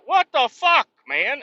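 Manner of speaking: high, loud mock-outraged; hammed-up indignant shout; exasperated outburst. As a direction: yell at the fake sign. Retold reaction to missing Casey's.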